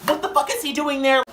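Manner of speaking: high, loud mock fury; going off. Mom hearing about the guest by phone, going off. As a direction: imitate a mom exploding down the phone. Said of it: "just going off on the phone".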